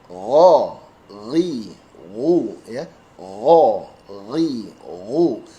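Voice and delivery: GHO, RI, WU each begun with a low, held gh; gh drill. Shown as a demonstration. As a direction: slowly voice the gh before each vowel. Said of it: "untuk huruf gho". (latihan makhraj gho, ri, wu)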